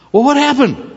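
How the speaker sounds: high, put-on interrogating voice; questioning master. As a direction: press the servant with a high-pitched question. Acted master questions servant.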